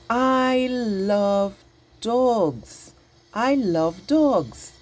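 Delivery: slow, stretched-out exclamation; excited. Shown as a demonstration. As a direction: draw out every word with big excitement. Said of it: "hear the feeling that I'm giving out".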